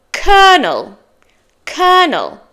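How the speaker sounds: colonel high then falling, said KER-nul; correct pronunciation. Shown as a demonstration. Teacher models word four for learners, correct pronunciation. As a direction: say colonel in two syllables like "kernel". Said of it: "but it's colonel".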